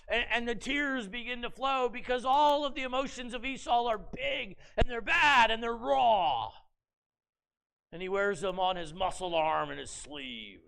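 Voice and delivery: normal talking voice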